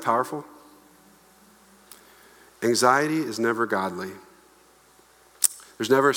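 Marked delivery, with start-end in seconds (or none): none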